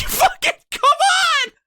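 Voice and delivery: high-pitched exasperated yell; exasperated outburst. Acted. Fed-up customer snapping at the drive-thru worker, high exasperated yell. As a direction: blow up in mock frustration, voice shooting up.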